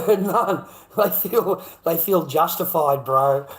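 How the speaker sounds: laughing scorn. Real, not acted.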